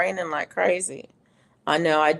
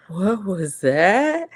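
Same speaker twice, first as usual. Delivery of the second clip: startled what was that; 4 semitones higher, 5 dB louder, much slower than usual. Retold or imitated. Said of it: "And I'm like".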